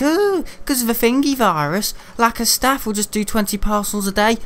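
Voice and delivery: put-on dismissive mimicry; mocking excuse. Mocking the delivery firm's excuse.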